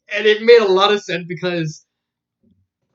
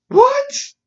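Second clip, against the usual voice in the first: very high, loud what; shocked squeak; 6 dB louder than usual. Retold or imitated. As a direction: squeak in disbelief. Narrator's shocked reaction.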